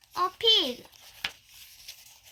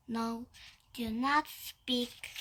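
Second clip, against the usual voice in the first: ordinary delivery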